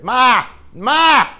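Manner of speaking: loud, high wail of Ma; baby bird hollering Ma from the ground; calling for mom. Retold or imitated. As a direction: yell up to the nest for mother. Chick calls up to nest.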